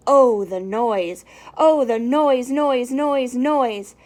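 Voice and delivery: loud, drawn-out groaning "oh, the noise"; exasperated. Acted. Grinch fed up with the Whos' noise, loud groaning.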